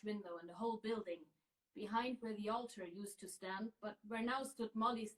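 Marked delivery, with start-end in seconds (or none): none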